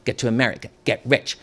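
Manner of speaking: plain reading voice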